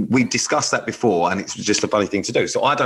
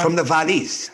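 lilting, higher-pitched mock Welsh; Welsh accent; 6 semitones higher than usual. Shown as a demonstration. Host demonstrating Welsh for the co-host, in a mock Welsh accent. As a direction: put on a Welsh lilt. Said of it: "trying to do Welsh".

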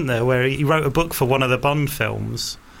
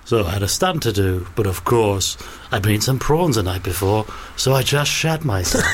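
lowered smooth Roger Moore voice; a lightly voiced Roger Moore, laugh breaking in at the end; Roger Moore impression; 5 semitones lower than usual. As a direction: tell it like a suave star's anecdote. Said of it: "Roger Moore on the set going".

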